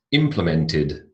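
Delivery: "implemented" ending in a separate "id" syllable. Shown as a demonstration. Teacher models past-tense endings to class, id ending on "implemented". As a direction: sound the "ed" of "implemented" as id. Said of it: "the id sound".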